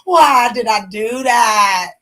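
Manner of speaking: slow, loud panicked shout; panicked cry. Retold or imitated. Sinner realizing too late, panicked slow shout. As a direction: cry out in regret. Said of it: "You're like".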